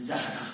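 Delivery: زہرا said as za-ha-ra, open "a" after z; correct pronunciation. Shown as a demonstration. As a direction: say زہرا with a short a on the first syllable, "ZA-hra". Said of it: "اس کا pronunciation".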